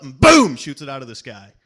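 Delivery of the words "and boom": a sudden loud boom for the gunshot; shouted boom. Acted. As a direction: bark out the shot. Shot in the joke, shouted.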